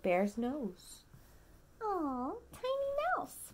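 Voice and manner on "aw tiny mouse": very high, soft coo. Acted. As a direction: coo it like something cute. Cooing over tiny mouse.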